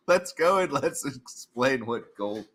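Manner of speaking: normal talking voice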